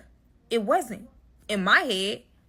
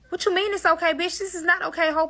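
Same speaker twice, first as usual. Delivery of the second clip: angry retort; 7 semitones higher than usual. Retold or imitated. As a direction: snap back, outraged.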